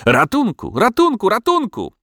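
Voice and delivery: high, panicked yelling; frantic dog's cry for help; yelled cry for help. A narrator's reading. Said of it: "wrzasnął garm".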